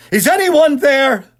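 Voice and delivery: frightened shout into an empty room. Acted. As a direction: call out into the dark. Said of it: "he knew he had shouted".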